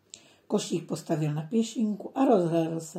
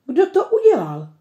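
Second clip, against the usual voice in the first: high, loud cross demand; a little girl cross about the picked strawberries; angry question; 8 semitones higher, 11 dB louder than usual. A narrator's reading. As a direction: scold like an upset child. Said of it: "zlobí se".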